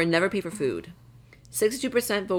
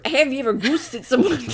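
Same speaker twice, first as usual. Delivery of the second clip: higher, laughing mock Irish; Irish accent; 5 semitones higher than usual. Shown as a demonstration. Asking a friend about ghosting, giggly Irish accent. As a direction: play at being an Irishman. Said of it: "Why is like an Irishman?".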